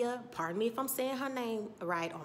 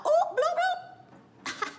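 very high, loud mock-scandalized whoop; comic shocked whoop; 9 dB louder, much slower than usual. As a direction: squeal it out, tickled by the burn. Her delighted reaction to the insult.

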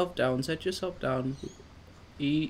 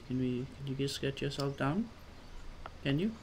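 ordinary talk

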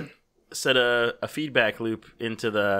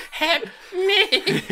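mock cry for help; 4 dB louder than usual. Mock cry during robot fight.

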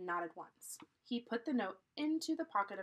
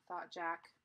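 ordinary delivery, nothing marked

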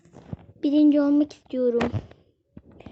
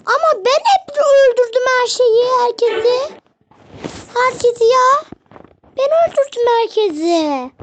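much higher and louder, whiny outrage; high indignant protest; 9 semitones higher, 6 dB louder than usual. Real, not acted. Gamer protests to viewers he killed everyone, high indignant whine. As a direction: complain like it's deeply unfair. Protesting after losing in game.

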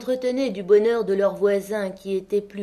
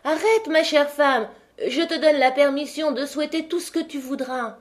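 higher, louder, pleading call; husband shouts to his wife at the open window, pleading; crying out to stop her; 6 semitones higher, 3 dB louder than usual. Acted. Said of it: "lui cria".

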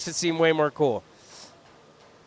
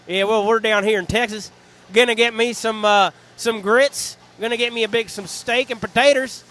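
put-on Texas cowboy accent, half-joking; 3 semitones higher, 5 dB louder than usual. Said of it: "Can you speak with a Texas accent?".

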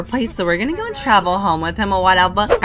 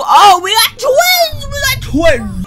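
squealing much higher and louder, slowly; thrilled surprise; 7 dB louder, slower than usual. Real, not acted. Player finding the baby is twins, squealing surprise. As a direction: shock and delight all at once.

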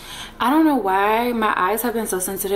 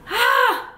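short, very high, loud yelp; high nervous yelp; nervous squeal; 5 dB louder than usual. Real, not acted. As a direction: squeal to psych yourself up. Nervous before the second shot.

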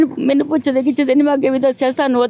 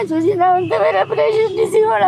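crying; 9 semitones higher than usual. Real, not acted. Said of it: "ਰੋਵੋ ਨਾ, ਰੋਵੋ ਨਾ".

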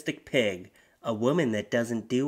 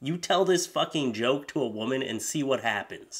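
usual speaking voice

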